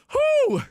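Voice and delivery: high, loud whew; winded whew. Real, not acted. Streamer finishes dancing for viewers, high winded whew. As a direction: let out a big high whoop of breath.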